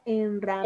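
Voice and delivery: Enramada broken off to point out MA; teacher's syllable demo of Enramada; stress on MA in Enramada. Shown as a demonstration. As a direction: sound out Enramada towards the stressed MA. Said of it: "¿Dónde va el acento tónico?".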